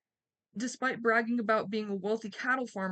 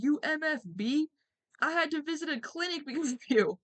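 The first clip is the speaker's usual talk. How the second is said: mock furious shout, much higher and louder; furious all-caps yell; 7 semitones higher, 3 dB louder than usual. Reading the farmer's angry email, a mock furious shout. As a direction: yell it like an enraged man reading his email. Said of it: "an email written in all caps".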